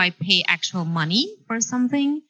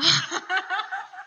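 soft laugh; 11 semitones higher, 5 dB quieter than usual. Real, not acted. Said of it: "I think you answered that really, really well".

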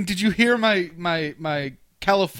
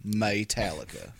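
said just so; 9 semitones lower, 8 dB quieter than usual. Shown as a demonstration. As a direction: say the name deep and soft, like a signature. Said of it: "you have to say it like that too".

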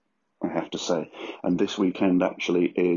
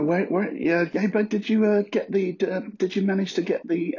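much higher, fussy phone voice; mock confused caller; 13 semitones higher than usual. Pretending to be the baffled one on the phone, high and fussy. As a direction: play the puzzled one on the line.

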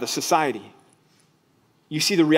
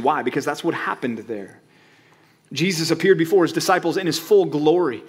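usual speaking voice

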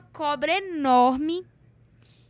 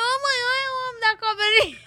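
excited calling out; 9 semitones higher, 5 dB louder than usual. Retold or imitated. Daughter spots the snake man and calls mom, excited. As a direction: alert your mother in a mock wail. Child calls out to warn mother.

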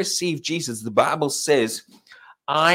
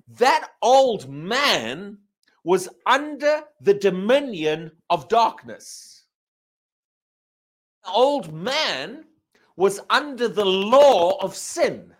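slow, weighty, each word leaned on; solemn, deliberate preacher cadence; emphatic slow preaching; 4 semitones higher, 4 dB louder, slower than usual. Real, not acted. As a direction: drag it out to drive the point home.

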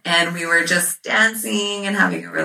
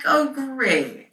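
high-pitched mock-delighted sarcasm; retold sarcasm; 9 semitones higher than usual. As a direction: fake enthusiasm, dripping with irony. Sarcastic reply to abortion order.

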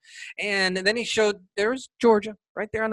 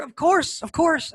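excited greeting; 10 semitones higher than usual. Real, not acted. Host greets his dog.

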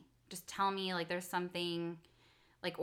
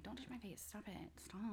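very soft parental warning; hushed scolding; 17 dB quieter than usual. Retold or imitated. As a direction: tell off the kids under your breath. Parent scolding kids, retold under breath.

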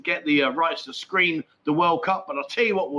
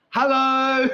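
a high, louder, drawn-out hello; cheeky hello; 7 semitones higher, 4 dB louder than usual. Real, not acted. Vlogger greeting Sandra if she's watching, cheeky sing-song.

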